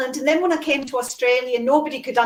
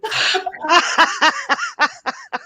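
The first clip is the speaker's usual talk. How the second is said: long, higher-pitched laughing fit; big laugh; 6 semitones higher, 4 dB louder than usual. Real, not acted. Woman picturing British accent with southern twang, long high laugh. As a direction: crack up for a couple of seconds.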